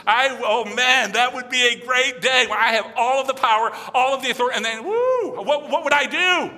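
high, loud, hammed-up excitement with a whoop; gleeful fantasizing. Preacher acting out a revenge fantasy, gleeful excitement. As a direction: revel in imagined power, pitch soaring.

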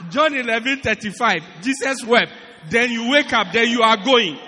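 loud, mocking quick verse-and-go; mocking loud recital. Preacher mocks members who read one verse and leave, loudly. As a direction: rattle it off loud to mock the lazy reader.

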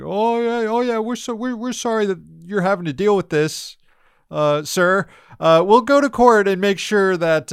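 high-pitched, fawning apology; mock groveling. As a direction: imitate officials groveling to someone powerful. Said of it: "the Biden administration just grovels".

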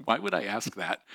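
normal talking voice

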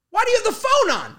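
exasperated yell. Retold or imitated. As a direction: yell the question at dad. Exasperated son on phone.

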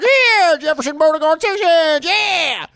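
high hollering Southern caricature; Jeff Sessions. Pretend. Host breaking back into a politician impersonation mid-disclaimer, high mock holler. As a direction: whoop it out like a hammed-up cowboy. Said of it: "I do a Jeff Sessions impersonation".

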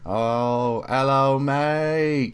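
high, stretched Aussie hello; Australian. Acted. Host riffing on Shaun of the Dead in Australian. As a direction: sing out hello mate like a bloke from Sydney. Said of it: "you were doing Australian".

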